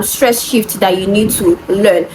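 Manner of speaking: ordinary delivery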